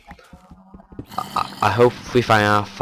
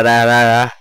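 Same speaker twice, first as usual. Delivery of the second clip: ordinary talk, nothing marked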